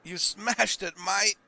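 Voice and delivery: high, loud hammed-up Aussie; terrible Australian accent. Gamer echoing the game's praise, terrible Australian accent. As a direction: ham up a bad Australian accent, pitched high. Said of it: "my terrible Australian".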